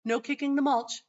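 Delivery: firmer repeat. Retold or imitated.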